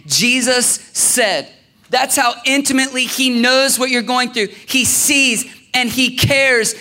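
a pastor lifting his congregation, preaching. Acted. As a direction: build the declaration with rising conviction. Preaching builds over music.

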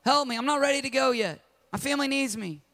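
high, pleading cry for help; pleading drowning man. Retold or imitated. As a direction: beg God from the rising water.

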